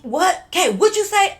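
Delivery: startled. Retold or imitated. Host confronts her.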